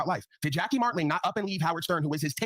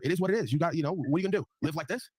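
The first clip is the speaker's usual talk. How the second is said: ordinary talk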